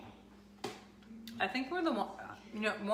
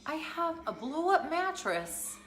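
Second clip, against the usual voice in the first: exasperated husband; 10 semitones higher, 4 dB louder than usual. Retold or imitated. Husband's exasperated reply, retold.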